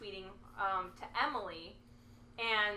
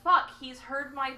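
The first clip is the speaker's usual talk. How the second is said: mock panic; 8 dB louder, slower than usual. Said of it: "I literally was like".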